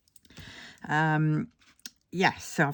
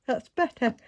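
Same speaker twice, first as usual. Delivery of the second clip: after a yawn; 7 semitones higher than usual. Real, not acted. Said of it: "I apologise, I keep yawning".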